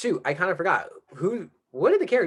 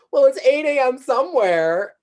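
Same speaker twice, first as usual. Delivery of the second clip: smug lady; 10 semitones higher, 6 dB louder than usual. Retold or imitated. Lady voiced in ad retelling.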